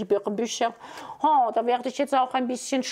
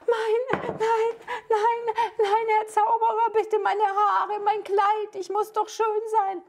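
high, crying princess voice begging; frightened princess; 10 semitones higher, slower than usual. Acted. Captured princess begging the wizard to spare her hair, high crying. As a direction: whimper and plead like a vain young princess.

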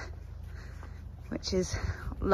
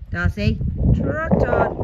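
drawn-out command; 3 semitones higher, 6 dB louder, slower than usual. Real, not acted. Calling horse to trot.

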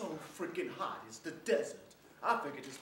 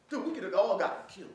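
loud, raised-pitch protest; upset outburst; 5 semitones higher, 10 dB louder than usual. Acted. Soldier after crash to laughing buddies, upset. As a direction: burst out at your buddies.

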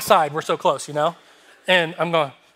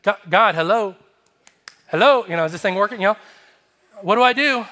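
louder, higher mock-desperate appeal; exasperated calling out; 4 semitones higher, 5 dB louder than usual. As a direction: call out as if the line went dead. Calling to silent God for help.

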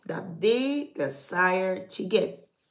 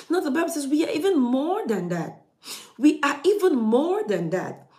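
higher preaching voice stressing even; preaching emphasis; 7 semitones higher, 4 dB louder than usual. Acted. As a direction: lift the congregation on "even". Preaching rises in pitch.

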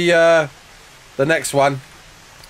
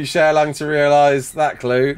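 ordinary talk, nothing marked

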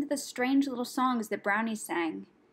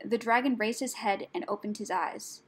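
usual speaking voice

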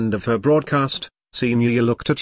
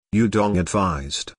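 ordinary delivery, nothing marked

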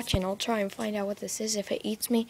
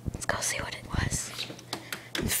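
whispering; 5 dB quieter than usual. Real, not acted. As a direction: whisper it so nothing hears. Sneaking toward the noise at night.

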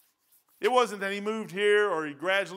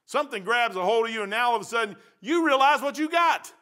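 loud, high preaching climax; fiery preaching; 6 semitones higher, 8 dB louder than usual. Acted. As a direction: drive it up to the peak of the sermon. Preacher climbing to a peak.

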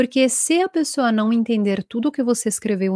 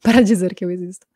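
laughing; 2 semitones lower, 4 dB louder, faster than usual. Real, not acted. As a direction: repeat it through a real laugh. Repeats the phrase laughing. (rindo de verdade)

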